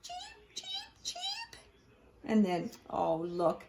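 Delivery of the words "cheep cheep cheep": tiny high whispered cheeps; baby chick cheeps. Acted. Newborn chicks peep on Gerald's head, tiny whispered cheeps.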